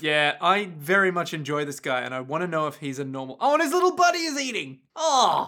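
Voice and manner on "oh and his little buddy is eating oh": high, quick burst of delight; real high-pitched cooing excitement. Real, not acted. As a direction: gush over something cute. Delighted at creature's little buddy.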